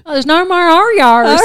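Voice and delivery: loud high words through laughter; laughing. Real, not acted. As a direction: laugh the line out. Quoting a TikTok, laughing.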